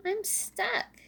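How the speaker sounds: high squeaky little squirrel. Acted. Stuck Little Squirrel tells Daddy, high and worried.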